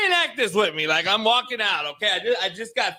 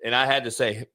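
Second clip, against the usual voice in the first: ordinary talk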